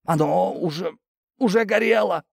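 high, halting moan; pained moaning. A narrator's reading. Tortured worker says it was already burning, moaning. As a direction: groan it brokenly through pain. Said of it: "простонал парень".

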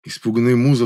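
ordinary delivery, nothing marked

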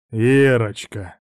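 slow, oily, wheedling; drawn out and insinuating; ingratiating. A narrator's reading. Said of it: "вкратчиво начал собеседник".

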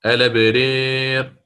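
الأبرير read slowly as "elaberer", hamza vowel on the lam; slow naql reading. Shown as a demonstration. Teacher repeats Warsh reading of الأبرير for students, slow. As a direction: stretch الأبرير out with the transferred vowel. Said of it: "بالنقل".

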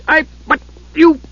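high, infuriated splutter in broken bursts; splutter, too furious to finish. A narrator's reading. Infuriated dwarf tries to answer, spluttering. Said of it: "he started to splutter".

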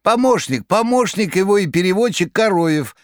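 very high, crackling chatter; rattling chatter. A narrator's reading. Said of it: "трещала трубка".

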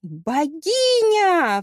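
drawn-out cry, high and loud; exclaimed cry. A narrator's reading. Hero hailing a supposed goddess, high loud cry. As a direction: cry it out at full voice, stretching the middle. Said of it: "вскричал тот".

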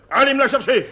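angry order. Acted. General ordering the woman to fetch her, angry. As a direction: send them off with an impatient shout.

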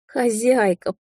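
high, drawn-out raccoon plea; desperate little animal begging; pleading. A narrator's reading. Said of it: "взмолился енот".

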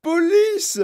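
very high, loud cries for help; frantic mother shrieking; hysterical yell. A narrator's reading. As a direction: scream for the police in panic. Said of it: "hurla madame Gloop".

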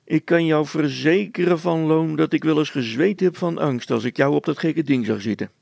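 loud, high emphatic teasing; mocking. A narrator's reading. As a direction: hammer it home officiously. Constable keeps mocking the shopkeeper.

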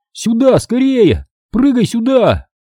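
high, urgent drawn-out call; crying out. A narrator's reading. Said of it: "закричал я". (настойчиво кричит собаке)

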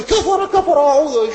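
high mock cry of kufr; mocking outraged critic. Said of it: "يخلي يقول". (تقليد ساخر لمعترض مذعور بصوت عالٍ)

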